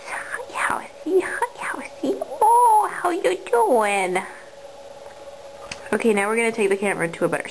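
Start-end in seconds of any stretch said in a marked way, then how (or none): 0.1-2.2 soft whisper to a pet. Real, not acted. Greeting the rabbit in whisper. 2.4-4.2 high, slow cooing voice. Real, not acted. Cooing at the rabbit.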